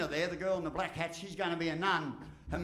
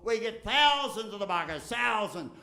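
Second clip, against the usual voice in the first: emphatic; 4 semitones higher, 8 dB louder than usual. Real, not acted. Exclaims festival crowds.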